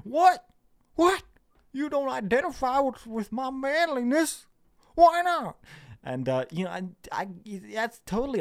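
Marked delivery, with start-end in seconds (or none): 0.0-5.6 very high, loud mock outrage; hammed-up squawk of wounded disbelief; affronted manly-man caricature. Manly man shocked at being questioned, high mock outrage.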